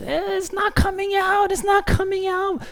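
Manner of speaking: put-on very high whiny little girl voice; toddler daughter voice. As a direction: whine it like a stubborn toddler. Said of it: "and she's".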